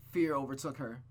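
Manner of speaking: ordinary delivery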